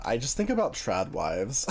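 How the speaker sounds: usual speaking voice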